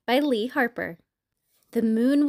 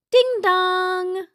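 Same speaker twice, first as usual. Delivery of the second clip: sing-song two-note doorbell; doorbell chime; 5 semitones higher, 4 dB louder than usual. A narrator's reading. Doorbell rings at the farmhouse, sing-song chime. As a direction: chime it high, holding the last note.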